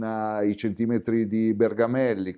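ordinary talk

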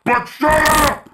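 high, loud, slow shut up; mock angry shout. Real, not acted. Gamer yelling shut up while amused. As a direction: bark it half laughing.

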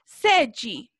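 "sede" said SEH-dji; final DE as "dji". Shown as a demonstration. Teacher modelling "sede" for pupils to repeat, ending in "dji". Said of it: "lo vamos a pronunciar como G".

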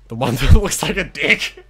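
loud, high laugh breaking through the words. Real, not acted. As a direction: crack up mid-sentence. Laughing at a rude joke.

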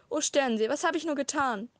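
despairing father cries out after his daughter; howling in despair. A narrator's reading. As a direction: wail it into the night. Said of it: "heulte er".

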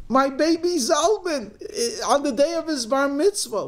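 high, loud theatrical wail; hammed-up crying lament. Crying daughter telling mother her grief, hammed-up wail. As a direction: lament a lost baby at high pitch. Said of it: "fifteen-year-old crying".

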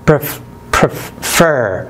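pref whispered quick, then prefer; quick first syllable. Shown as a demonstration. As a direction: snap the pre short. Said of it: "سريع".

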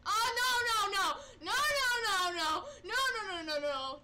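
high, loud, tearful no's; frantic pleading; panicked no's. Real, not acted. Gamer seeing Golden Freddy, high loud panicked no's.

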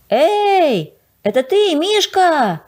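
громко и высоко окликает «Эй, это ты»; крикните вниз с обрыва. A narrator's reading. Окликает друга внизу обрыва. (мальчик громко окликает друга внизу обрыва)

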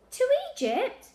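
very high, loud rising question; incredulous echo. A narrator's reading. Child echoing grandad's destination, incredulous. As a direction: echo it back in disbelief. Lily echoes grandad in disbelief.

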